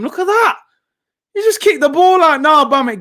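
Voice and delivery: high, loud, incredulous protest; exasperated outcry. Real, not acted. Fan yelling at Aubameyang kicking it out, exasperated. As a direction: cry out in disbelief at the mistake.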